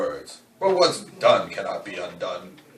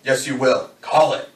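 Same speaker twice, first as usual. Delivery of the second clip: louder, higher push; insistent command; 5 semitones higher, 8 dB louder than usual. Acted. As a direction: press her hard to answer. Killer pressing the coin toss.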